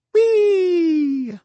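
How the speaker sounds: high, loud wordless shriek; child's shriek. Acted. Ellie shrieking in panic, high and loud. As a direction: let out a scared child's squeal.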